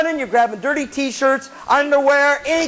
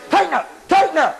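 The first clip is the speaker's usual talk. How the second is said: higher, urgent order; bossy upperclassman; 7 semitones higher than usual. Acted. Upperclassman to a confused freshman on the field, bossy. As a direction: order it twice, sharply. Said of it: "upperclassman that says".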